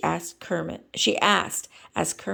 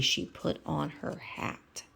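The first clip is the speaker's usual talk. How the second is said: whispering; 9 dB quieter than usual. Acted. Whispered narration.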